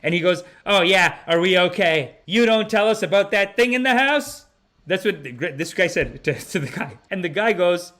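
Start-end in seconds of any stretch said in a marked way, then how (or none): 0.6-4.5 louder, higher, rattled complaint; hammed-up indignant snap; mock angry outburst. Guest phones the homeowner, mock angry and louder.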